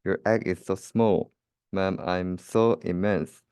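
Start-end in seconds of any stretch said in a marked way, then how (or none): none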